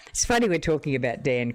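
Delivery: ordinary talk, nothing marked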